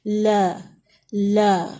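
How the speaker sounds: لا with the vowel between a and e; لا neither open nor narrow; middle vowel demo. Shown as a demonstration. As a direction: say لا with a mid, half-open vowel. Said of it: "اس کے درمیان کی جو position آتی ہے".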